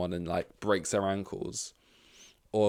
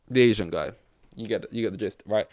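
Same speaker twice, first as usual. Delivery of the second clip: ordinary talk, nothing marked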